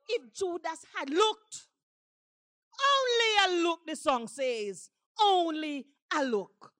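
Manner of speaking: stretched, emphatic pulpit delivery; impassioned preaching. Acted. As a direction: proclaim each phrase with rising fervor. Preaches song lyrics at full force.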